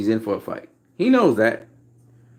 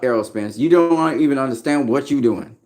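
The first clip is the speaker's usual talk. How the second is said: ordinary talk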